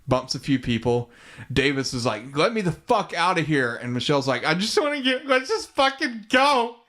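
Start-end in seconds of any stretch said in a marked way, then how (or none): 2.3-3.8 higher-pitched, mildly urgent quote; mock demand. Retelling a passenger wanting out of the car, mock demand. Acting out Davis demanding to leave. 4.4-6.8 high-pitched imitation of panicked begging; mock frantic plea. Voicing the girl begging to leave the fight, mock frantic plea.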